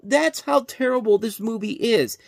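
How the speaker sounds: usual speaking voice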